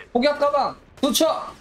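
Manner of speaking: high, commanding battle cry; battle roar. Acted. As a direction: bellow the drop order. Ordering satchel charge drop.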